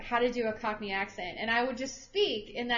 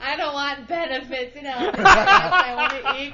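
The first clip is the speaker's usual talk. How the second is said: loud, hammed-up wail with laughter; slow, laughing fake-crying complaint; mock tantrum; 4 semitones higher, 10 dB louder than usual. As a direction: bawl it in playful protest, building louder. Mock tantrum about a real career.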